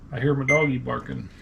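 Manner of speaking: ordinary delivery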